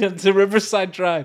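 ordinary talk, nothing marked

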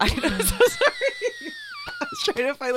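laughing apology, high and loud. Real, not acted. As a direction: giggle through the apology.

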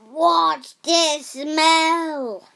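slow, loud drawn-out giant's question; suspicious giant. Acted. Giant sniffing the air in his kitchen.

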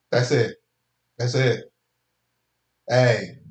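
ordinary delivery

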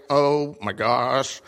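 slowed, breathy awe; slow dazzled oh my gosh; slow awed gasp. Retold or imitated. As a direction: drag out the wonder. Inner awe at practice.

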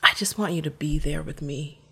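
whispered tender plea; father whispering love over the phone; soft whisper. Retold or imitated. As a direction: hush it to her gently. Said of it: "He was so soft".

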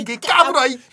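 mock thug threat. Quoting the anime insult scene.

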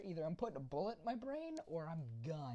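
normal talking voice

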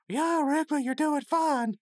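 very high, loud mock falsetto; squeaky, loud put-on reassurance; puppeted friend's voice. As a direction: imitate someone speaking for a limp body like a puppet. Rigby speaks for limp Mordecai.

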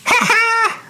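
high gleeful cackle from the audience; mock cackle. Cheering a contestant's loss, high gleeful cackle. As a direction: cackle in mean delight. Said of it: "you'd hear me go in the audience".

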